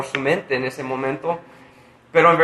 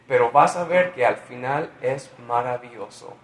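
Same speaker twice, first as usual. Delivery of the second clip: ordinary talk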